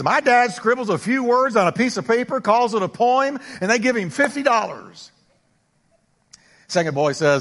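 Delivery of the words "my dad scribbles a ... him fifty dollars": lightly voiced boy, higher, showing off; a kid boasting in the schoolyard, pitched up; first boy bragging. Preacher voicing a bragging schoolboy, higher and proud. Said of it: "The first boy says".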